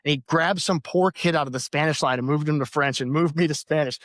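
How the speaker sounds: ordinary talk, nothing marked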